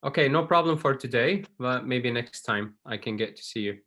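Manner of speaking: ordinary delivery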